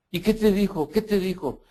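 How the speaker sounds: put-on a curious child bursting to know; eager little girl. Priest voicing the curious girl pressing him eagerly.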